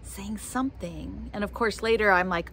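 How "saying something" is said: ordinary delivery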